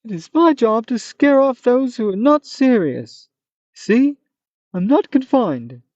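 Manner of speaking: a hammed-up high monster; Manticore voice. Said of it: "this funny Manticore voice".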